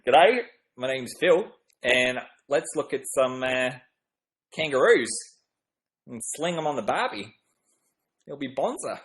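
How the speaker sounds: hesitant put-on Australian accent. Shown as a demonstration. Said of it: "Okay, one more Australian".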